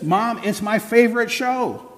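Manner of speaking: put-on high, slow teen protest; whiny teenager. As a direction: plead your case to Mom. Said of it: "And Susie says".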